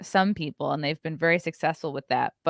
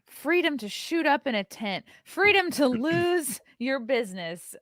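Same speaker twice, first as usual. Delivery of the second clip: higher-pitched parody of an ad; mock-grand sloganeering; mocking slogan; 6 semitones higher than usual. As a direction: draw it out like a sarcastic campaign promise. Parodies the ad's freedom slogan.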